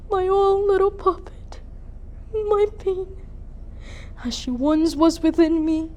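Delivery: high-pitched grief building to an outburst; mourn, then burst out loudly in bitterness; anguished lament. Acted. Mother laments her girl curled for that boy, anguished.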